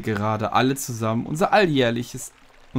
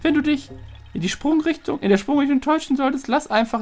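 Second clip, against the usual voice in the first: much higher, cheery little voice; high praising spirit; 14 semitones higher than usual. Acted. Forest spirit praises the climbing hero, in a much higher voice. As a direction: cheer him on in a tiny high voice.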